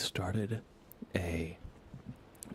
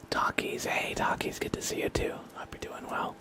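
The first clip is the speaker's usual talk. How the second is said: whispered thanks; 6 dB louder than usual. Acted. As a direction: breathe the thanks to chat in a whisper. Whispered chat thanks at stream start.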